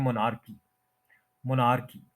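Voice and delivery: "मोनार्की" as mo-NAAR-kee; common mispronunciation. Shown as a demonstration. Teacher quoting common usage, mispronounced with naar. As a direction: stress a long naar in "मोनार्की". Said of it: "बहुत common हम".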